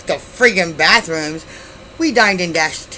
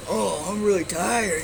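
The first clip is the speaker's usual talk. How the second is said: put-on slow, sleepy mumble of a tipsy boyfriend; drowsy drunk boyfriend; 3 semitones higher, 4 dB quieter, slower than usual. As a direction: drag it out like you can barely stay awake.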